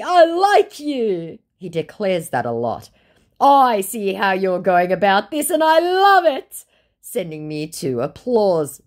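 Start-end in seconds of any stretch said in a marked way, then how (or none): 0.0-1.4 high, slow, syrupy mock flirt; a sappy scammer voice, slowed right down. Scammer's flirty message read aloud. 3.4-6.6 high and gushing, rising on love; syrupy mock praise, slowing at the end; mocking scammer voice. Scammer's praise read aloud.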